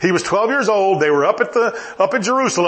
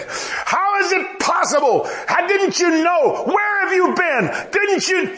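upset mother; 11 semitones higher, slower than usual. Retold or imitated. Mom scolding the lost twelve-year-old Jesus, high and frantic. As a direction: play a frantic mother scolding her boy. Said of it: "Mom got upset at him and said".

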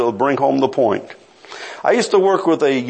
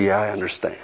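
quiet tearful reply; 7 semitones lower, 6 dB quieter, faster than usual. Retold or imitated. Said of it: "tears began to run down his cheeks".

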